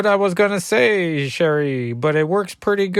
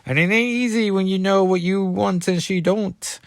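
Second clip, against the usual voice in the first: put-on higher-pitched Cajun accent; Gambit; 5 semitones higher than usual. Gambit answering Reptile's jibe, higher Cajun accent. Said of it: "I was taking over Gambit".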